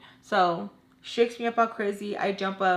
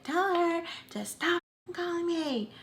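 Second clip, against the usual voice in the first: mom's exasperated complaint; 9 semitones higher than usual. Retold or imitated. Mom complaining to Tommy about the calls, imitated exasperation. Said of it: "my mom was telling her".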